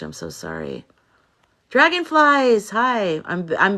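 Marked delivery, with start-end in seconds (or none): 0.0-0.8 softly breathed sorry, real; hushed condolence. Real, not acted. She consoles a grieving mother, hushed. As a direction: drop to a near-whisper of sympathy. Viewer's son and uncle died.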